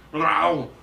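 put-on forceful Austrian-accented action hero; action-hero impression. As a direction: punch out commands like an action hero. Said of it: "Do you do any other impressions?".

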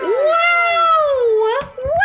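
high, loud shout of delight; excited wow. Real, not acted. Streamer shouts at a viewer's sound alert, high and loud. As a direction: yell out the wow, thrilled.

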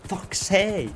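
high-pitched, frustrated curse; exasperated outburst. Real, not acted. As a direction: let the frustration burst out high. Exasperated after the mishap.

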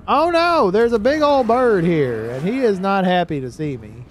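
alarmed. Real, not acted. Angry bird attacks in game.

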